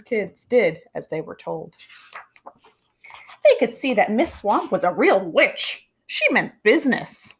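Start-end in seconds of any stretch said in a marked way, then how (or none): none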